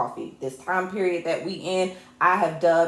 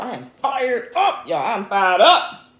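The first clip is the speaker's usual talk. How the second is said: slow, stretched-out rallying cry, punching UP; fired up; 4 semitones higher, 6 dB louder, slower than usual. Real, not acted. Hyping the viewers as y'all, fired up. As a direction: draw out each word and swing up on "up". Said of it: "I am so fired up".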